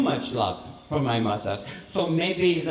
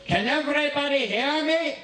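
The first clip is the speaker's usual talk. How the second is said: high-pitched call to the room; mock public call; 8 semitones higher than usual. Father addressing guests at the wedding party, mock calling out. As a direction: imitate someone pitching it up for a crowd.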